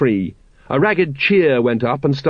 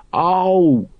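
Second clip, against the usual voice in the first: plain reading voice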